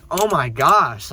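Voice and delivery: loud, slow oh my gosh; exasperated. Real, not acted. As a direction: drag it out in disbelief. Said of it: "exasperated rant".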